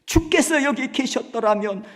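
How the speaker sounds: loud, high crying plea at his feet; wailing lament. Acted. Mary weeping at Jesus' feet, loud wail. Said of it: "하면서 막 우는 거예요".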